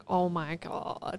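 soft, slow drawn-out awe; hushed, stretched-out mock astonishment; retold amazement. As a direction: breathe it out slowly, stunned. Stunned reaction of family retold.

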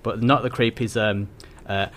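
ordinary delivery

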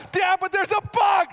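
high, loud frantic shout; panicked daughter. Retold or imitated. Dad imitating his daughter screaming about the bug. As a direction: yell it like a terrified little kid.

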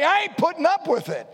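high, loud, defiant; defiant declaration. Shown as a demonstration. Preacher urging young people to stand up, defiant. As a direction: refuse to put up with it, spoken high. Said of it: "Talk like that".

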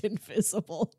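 ordinary talk, nothing marked

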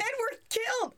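very high, incredulous echo; shocked repetition. Real, not acted. Reader echoes the co-host about the jumpers, shocked.

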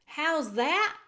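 put-on loud, high shout of a girl who mishears. A narrator's reading. Mig shouting back at the kitchen woman. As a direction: yell it back to be heard. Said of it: "shouted Mig".

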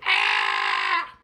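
low, loud scream cry. A narrator's reading. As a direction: let out a sudden scream. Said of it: "leap and scream with".

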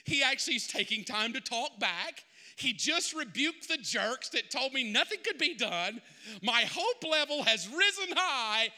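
ordinary talk

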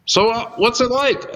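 high, easygoing call from above; Dwayne's voice. Retold or imitated. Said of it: "he goes".

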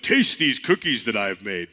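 Toad voice. Acted. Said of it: "Toad has to have a lower voice".

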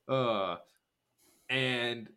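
ordinary delivery

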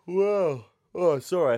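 yawning through the words; words stretched by a big yawn. Real, not acted. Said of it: "I still yawn".

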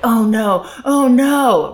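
mock mounting wail of a young child; upset six-year-old. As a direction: act out a kid starting to lose it. Six-year-old starting to escalate.

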